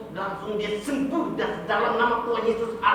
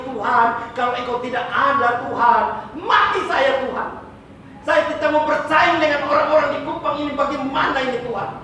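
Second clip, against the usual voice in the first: retold desperate prayer; 6 semitones higher, 6 dB louder than usual.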